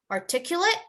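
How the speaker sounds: articulate: ending said -it, pitched high; verb form, stressed. Shown as a demonstration. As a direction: lift articulate high as you model it. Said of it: "But if it's a verb".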